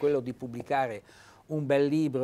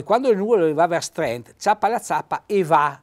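louder dialect proverb; Trentino dialect; 3 semitones higher, 6 dB louder than usual. Shown as a demonstration. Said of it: "ecco va".

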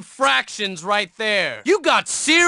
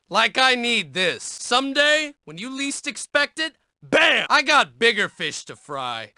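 ordinary talk

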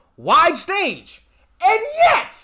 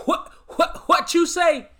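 higher, hammed-up Steve Harvey confusion; a comic Steve Harvey double take, pitched higher; Steve Harvey bit; 7 semitones higher than usual. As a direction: play Steve Harvey baffled by the news. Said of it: "in my best Godfrey doing a Steve Harvey impersonation".

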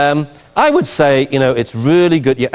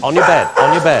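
firm command; 4 semitones higher than usual. Shown as a demonstration. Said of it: "she should have said".

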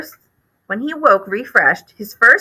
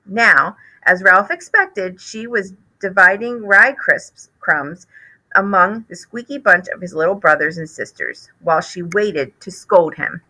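plain reading voice

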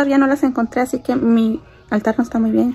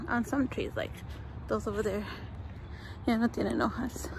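whisper; 11 dB quieter than usual. Real, not acted. Quiet outdoor aside.

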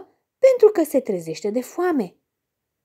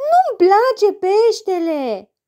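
put-on high, slow whiny girl; girl sulking over the cheese; fussy child; 8 semitones higher, 4 dB louder, slower than usual. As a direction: complain like a picky little girl. Child refusing fish.

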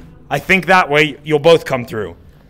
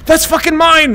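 higher, louder yelling in dismay; frustrated shout; 10 semitones higher, 4 dB louder than usual. Real, not acted. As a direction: yell it out, annoyed. Hit his own thing, shouting.

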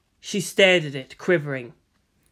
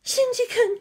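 put-on very high, small girlish voice; breathed. A narrator's reading. Captive woman calling the boy's name, breathed. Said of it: "she breathed".

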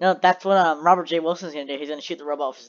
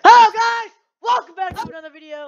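loud, high hype intro; excited greeting; 10 dB louder than usual. Real, not acted. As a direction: burst in with big energy. YouTuber opening greeting.

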